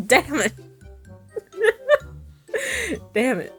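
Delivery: ordinary talk, nothing marked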